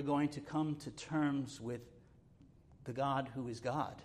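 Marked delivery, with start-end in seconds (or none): none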